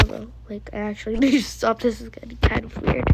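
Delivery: ordinary talk, nothing marked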